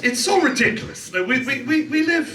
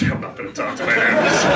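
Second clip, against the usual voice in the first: laughing refusal; 11 semitones lower, faster than usual. Retold or imitated. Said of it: "I'm like".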